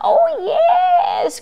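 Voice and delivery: a high, laughing shout of yes; cried with delight. A narrator's reading. Witch welcoming the bird aboard, laughing high cry. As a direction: whoop it out gleefully. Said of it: "cried the witch".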